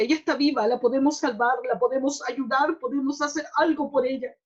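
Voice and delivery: re-live the desperate plea, loud but short of a scream; retold desperate shouting. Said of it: "yo le gritaba a mi esposo".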